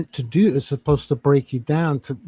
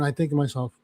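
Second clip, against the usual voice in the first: normal talking voice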